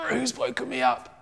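put-on grumpy voice of someone just woken; mock sleepy grump; grumpy waking. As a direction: grumble it, acting cross at being woken. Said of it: "he woke up a little bit grumpy".

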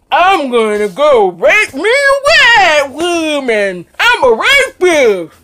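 loud, drawn-out, wailing caricature with high swoops; mocking caricature. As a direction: ham up a mocking stage caricature at full volume. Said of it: "in this mocking way".

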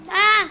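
high, very loud short cry; startled yell. Real, not acted. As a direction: cry out as if suddenly hit. Hit during game. (अचानक ज़ोर की चीख)

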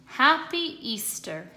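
plain reading voice

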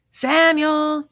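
loud, high drawn-out holler; yelling. A narrator's reading. Jack calls for his friend about the dog, yelling. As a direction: holler the name from another room. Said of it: "yelled Jack".

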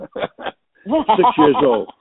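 slow, laughing through the words. Real, not acted. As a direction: chuckle it out at your own joke. Laughs at own joke.

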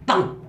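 a sharp spoken "bằng" mimicking a gun firing; mock gunshot sound effect; gunshot noise. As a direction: bark out a gunshot sound with the mouth. Imitating a gunshot.

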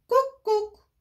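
high, loud cuckoo call; bright high cuckoo cry. A narrator's reading. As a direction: sing it out like a cuckoo clock bird. Cuckoo bird calls.

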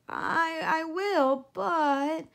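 hesitant piglet. A narrator's reading.

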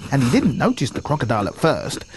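ordinary talk, nothing marked

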